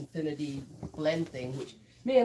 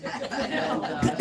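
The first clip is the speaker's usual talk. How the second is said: laughing through the words; real laughter breaking into the story; 6 dB louder than usual. Real, not acted. Laughing while retelling the phone call to Laura.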